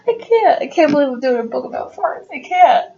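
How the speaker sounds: ordinary delivery, nothing marked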